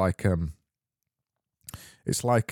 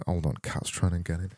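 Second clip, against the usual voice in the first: normal talking voice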